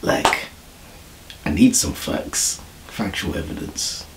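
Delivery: quiet, low, half-whispered quote; hushed imitation. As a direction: murmur it like a secret studio talk. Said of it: "He said".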